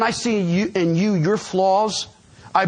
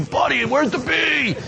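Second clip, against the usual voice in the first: put-on drunk shout; 9 semitones higher than usual. Drunk student hollering across class for a B. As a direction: yell it like a drunk kid in class.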